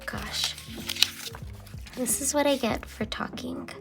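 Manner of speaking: ordinary talk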